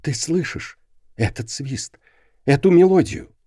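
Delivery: tense, rising with fear; frightened. A narrator's reading. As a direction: ask it scared and high. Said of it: "Он услышал страх в её голосе".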